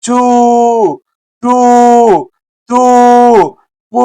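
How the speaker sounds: చు, టు, తు, పు each with a long, held 'oo'; long vowels. Shown as a demonstration. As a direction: stretch every vowel in చు, టు, తు, పు. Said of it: "అలాగే కాకి ఊ మాత్ర చేరిస్తే". (చు, టు, తు, పు దీర్ఘంగా సాగదీస్తూ)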